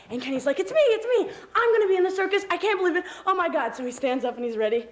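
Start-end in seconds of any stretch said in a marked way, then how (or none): 0.6-3.7 high, laughing excitement; excited. Acted. Kenny is picked for the circus, giddily excited. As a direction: gush like a thrilled kid.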